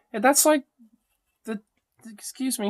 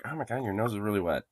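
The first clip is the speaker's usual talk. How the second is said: ordinary talk, nothing marked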